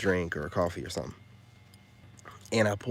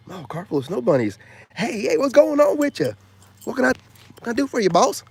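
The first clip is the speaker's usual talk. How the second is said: very high, loud hammed-up eagerness; high excited mock flirt; mock eager greeting; 14 semitones higher, 9 dB louder than usual. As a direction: play the overeager guy. Mock eager response he rejects.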